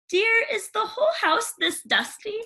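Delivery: high, sugary stepmother; fake-sweet disapproving question; prim stepmother sniffing at dust. Stepmother voiced in story.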